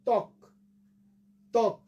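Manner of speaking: ত্বক as tawk, no final vowel; pronunciation demo. Shown as a demonstration. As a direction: stop ত্বক dead on the k. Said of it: "অটাকে বাদ দিয়ে উচ্চারণ করি". (ত্বক, শেষের অ বাদ দিয়ে)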